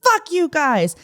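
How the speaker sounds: high-pitched angry outburst, retold; hammed-up furious cursing; mock yell. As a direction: imitate a man yelling back in defiance. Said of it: "he's yelling at me".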